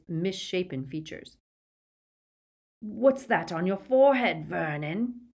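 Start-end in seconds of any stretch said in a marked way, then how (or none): none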